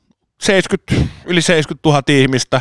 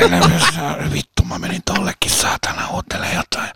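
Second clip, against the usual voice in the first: hushed whisper, swearing under breath; whispered aside; 3 semitones lower, 5 dB quieter than usual. Real, not acted. Telling his friend about the embarrassing moment, whispered curse.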